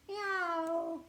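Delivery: high mock meow; cat meowing. Cat chased by the dog, high meowing. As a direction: mew like a little cat.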